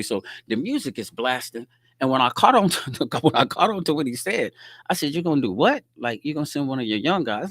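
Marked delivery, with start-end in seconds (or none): 2.0-4.5 high, loud, laughing it out; laughing in disbelief. Real, not acted. Telling a friend about a cocky kid, laughing. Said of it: "I'm laughing at him". 5.1-7.5 incredulous. Retold or imitated. Incredulous retort to a threat.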